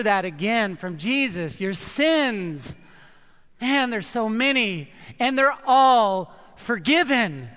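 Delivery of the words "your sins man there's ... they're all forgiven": loud, slow fervent proclamation; preaching at full force. Acted. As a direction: lift the whole room with it. Preaches forgiveness to congregation.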